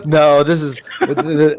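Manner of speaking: normal talking voice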